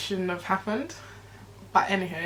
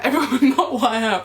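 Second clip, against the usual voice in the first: louder, higher, breaking into laughter; laughing correction; 5 semitones higher, 8 dB louder than usual. Real, not acted. Laughing as she corrects what she said about the girl. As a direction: catch yourself mid-laugh.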